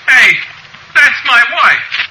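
high, loud startled outburst; shocked shouting. Acted. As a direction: holler at your wife in disbelief. Shocked husband calls out to wife.

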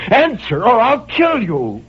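high, slow desperate cry; cried out. A narrator's reading. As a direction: cry it out as a final threat. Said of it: "And I cried out".

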